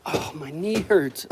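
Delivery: ordinary talk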